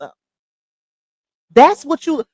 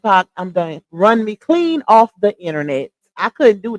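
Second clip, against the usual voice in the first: ordinary talk, nothing marked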